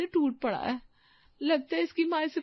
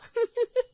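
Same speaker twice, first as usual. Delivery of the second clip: short higher snicker; mocking giggle; 7 semitones higher, 4 dB louder than usual. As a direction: giggle at the guest's clothes. Mocking the poor guest. (मज़ाक उड़ाती हँसी)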